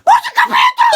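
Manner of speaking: shrill, urgent order. Acted. Bully girl shouts to her friend to hold the door.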